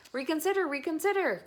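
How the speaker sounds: high, loud goose plea; crying goose. A narrator's reading. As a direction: plead like a honking goose. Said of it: "cried the goose".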